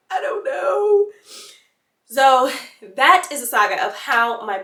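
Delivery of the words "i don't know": high, slow teary whimper; crying. Retold or imitated. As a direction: whimper it through tears. Crying reply.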